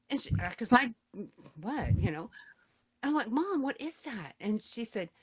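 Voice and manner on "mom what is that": appalled questioning. Retold or imitated. Presses mother again, retold.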